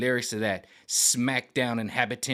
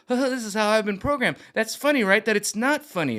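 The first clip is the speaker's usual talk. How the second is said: higher robotic mock voice; hammed-up programmed millennial, higher; mock programmed laugh; 9 semitones higher, 4 dB louder than usual. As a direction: sound like a laughing machine. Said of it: "more like a".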